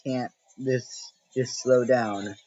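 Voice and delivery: slow, drawn-out exasperated plea; frustrated inner thought. Retold or imitated. Player watching spinning colors, slow exasperated inner plea. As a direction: stretch out the frustration, slowly. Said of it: "You're sitting there thinking".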